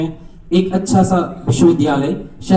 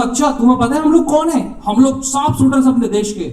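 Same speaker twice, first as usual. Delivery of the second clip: higher, mildly angry boast; indignant archers; 6 semitones higher than usual. Retold or imitated. Archers tell the heckler who they are, mock indignant. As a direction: puff up with offended pride. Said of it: "shooters को बहुत गुस्सा आ गया".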